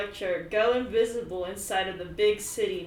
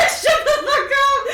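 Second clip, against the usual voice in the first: high, loud laughing outburst; laughing yell; 14 dB louder than usual. Real, not acted. Cracking up at her own imitation, loud and laughing. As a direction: crack up mid-shout.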